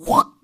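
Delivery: a sharp, very high outburst of anger; sudden angry burst. Pretend. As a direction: flare up all at once. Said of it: "이런 마음이 드시잖아요". (버럭 치미는 소리)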